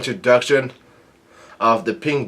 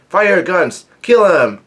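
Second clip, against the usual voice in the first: higher, louder imitated battle order; retold angry yell; 7 semitones higher, 6 dB louder than usual. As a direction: shout the command like an angry pirate captain. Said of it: "gets pissed off and he yells out".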